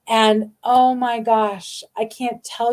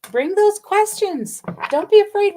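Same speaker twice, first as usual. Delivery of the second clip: emphatic urging; 11 semitones higher, 4 dB louder than usual. Real, not acted. Urging viewers to ask questions.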